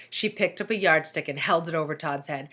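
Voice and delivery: usual speaking voice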